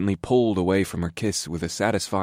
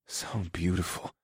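much softer murmur; murmured softly; 9 dB quieter than usual. A narrator's reading. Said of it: "I murmured softly".

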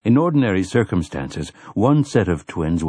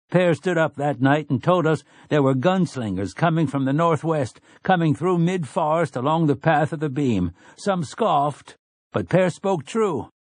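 higher townsman voice; townsman speaking up; 7 semitones higher than usual. A narrator's reading. Townsman's speech.

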